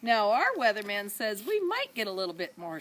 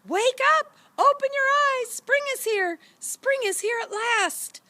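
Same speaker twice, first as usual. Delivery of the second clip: much higher, loud joyful shout; 8 dB louder than usual. A narrator's reading. Kids jumping on the parents' bed, shouting joyfully. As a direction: bounce on the bed and yell. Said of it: "jumped on the bed and shouted".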